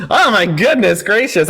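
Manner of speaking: usual speaking voice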